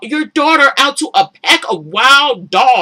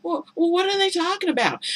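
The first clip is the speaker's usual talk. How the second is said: higher, softer, quick puzzled echo; mock confused; 8 semitones higher, 7 dB quieter than usual. Mocking Amina's clueless question to the show. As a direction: mimic her naive confusion. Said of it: "of course she's".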